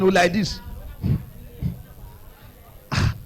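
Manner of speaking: normal talking voice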